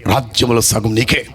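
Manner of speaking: low, slow royal voice; king granting favour. Acted. King raises sceptre to Esther, low regal pronouncement. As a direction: drop low and bestow it grandly. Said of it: "రాజదండం ఇచ్చి ఎత్తి అంటాడు".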